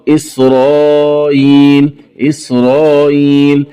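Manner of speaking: إسرائيل with hamza eased, long then shorter; softened hamza. Shown as a demonstration. Teacher showing both options, إسرائيل with softened hamza. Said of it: "بتسهيل الهمزة بين بين مع المد والقصر".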